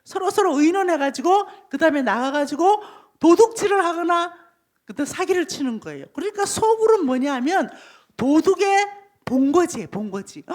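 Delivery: ordinary delivery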